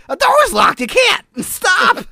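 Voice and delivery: high shouted clown threat; evil clown voice. Pretend. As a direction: yell it like a menacing clown. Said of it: "That's too much fun. I gotta stop doing that".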